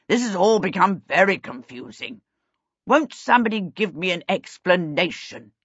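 a hint of a bewildered king asking for answers; puzzled royal voice, barely shifted from the narrator; lightly voiced old king. Confused king asks the room for an explanation, lightly voiced.

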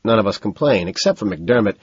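ordinary delivery, nothing marked

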